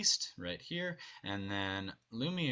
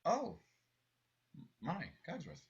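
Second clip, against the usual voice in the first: high, fading realisation; exclaim, then trail off in surprise; hammed-up surprise; 8 semitones higher, 4 dB louder than usual. Shown as a demonstration. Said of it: "is like".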